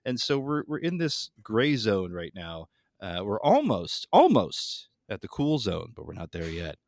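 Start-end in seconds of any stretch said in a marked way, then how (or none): none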